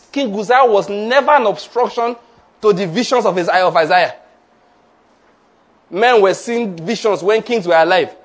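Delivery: fervent sermon delivery; preaching. Acted. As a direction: proclaim it to the church. Preacher at full force.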